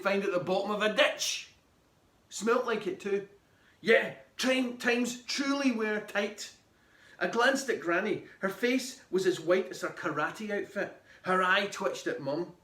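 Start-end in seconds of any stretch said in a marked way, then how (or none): none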